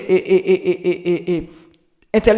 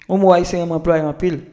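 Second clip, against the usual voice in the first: ordinary talk, nothing marked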